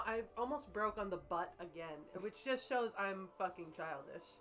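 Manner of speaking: ordinary talk, nothing marked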